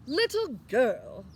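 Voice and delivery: slow, loud, pompous address; grand archduke. Acted. Archduke addressing Annabelle grandly, slow and loud. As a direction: boom it down at a child. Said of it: "said the archduke".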